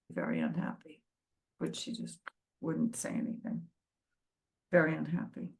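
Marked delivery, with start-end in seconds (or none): 0.1-1.0 very unhappy dropped low and soft; very unhappy murmured much lower and softer; hushed emphasis. Retold or imitated. 1.6-3.7 dropping to a near whisper, softer; letting the words sink to a hush, as if sharing a secret; daughter's secret about the grandmother, retold in a soft hush; hushed confiding. Quoting daughter about grandmother's unhappiness.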